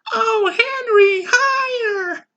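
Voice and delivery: high, slow moaning cry. Acted. Wife moaning directions to husband in bed, high cry. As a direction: moan high and drawn out.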